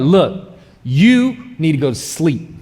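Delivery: slow, firm wife telling him off; mock stern wife. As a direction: lay it down slowly like a no-nonsense wife. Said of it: "she'll be like".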